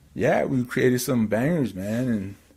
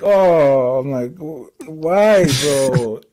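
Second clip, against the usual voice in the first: mock dismay; 4 semitones higher, 7 dB louder, much slower than usual. Acts out embarrassed protest to Ernie.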